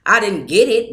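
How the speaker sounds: plain reading voice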